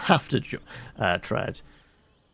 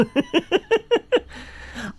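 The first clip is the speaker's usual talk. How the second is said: burst of laughter. Real, not acted. Laughs at his own slip.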